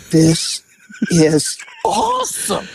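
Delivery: high, slow, drawn-out gleeful wonder; stretched-out amazed delight; delighted awe. Retold or imitated. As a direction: savour every word of it like the best show ever. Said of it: "I just stood there and said".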